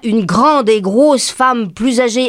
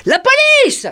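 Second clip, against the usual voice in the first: a much higher shouted alarm; sudden high alarmed cry; slower than usual. Retold or imitated. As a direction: call out the police warning. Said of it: "quelqu'un s'est mis à crier".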